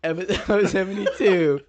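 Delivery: loud laugh breaking the line; giggling announcement; laughing through words. Real, not acted. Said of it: "tears are rolling down my face".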